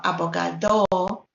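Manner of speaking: "abogado" wrongly stressed on final DO; misplaced stress on DO. Shown as a demonstration. Teacher showing another mistake, "abogado" stressed on final DO. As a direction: drag out the last syllable, DO, in "abogado". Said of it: "or abogado".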